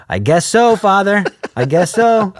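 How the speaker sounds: mock reluctant teen giving in; reluctant agreement. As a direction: sigh it out like a cornered kid. Reluctant reply to pastor.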